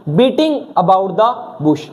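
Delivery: beating about the bush said very slowly, word by word; slow dictation. Shown as a demonstration. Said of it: "एक idiom भी देख लो".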